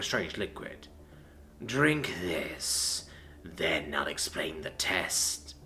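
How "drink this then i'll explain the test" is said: low, slow, soft stern command; a grim father giving orders. A narrator's reading. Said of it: "said with a stern voice".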